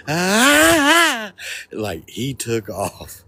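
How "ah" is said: loud, very high mock scream "ah"; high-pitched imitation of a fleeing man's scream; imitated scream. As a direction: shriek high, like his scream kicking into turbo. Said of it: "that scream, it was like".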